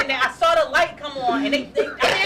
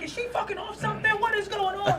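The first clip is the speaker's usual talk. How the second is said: higher mock of a confused man; confused booker; 10 semitones higher than usual. Booker wonders if the comic is on something, mock confused. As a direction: play his confusion big. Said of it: "he was like".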